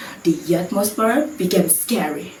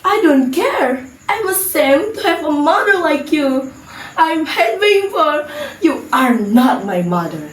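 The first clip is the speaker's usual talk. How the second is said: hasty; 5 semitones higher than usual. Acted. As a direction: spit it out in scorn. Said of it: "Sinta answered hastily".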